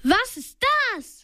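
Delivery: narrator's modest cry of alarm; called out. A narrator's reading. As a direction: lightly voice a startled call. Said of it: "rief Bob".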